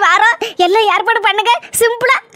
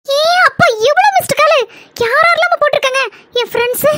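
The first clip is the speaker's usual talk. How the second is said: surprised; 6 semitones higher than usual. Acted. Finds many missed calls on phone.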